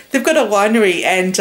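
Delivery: usual speaking voice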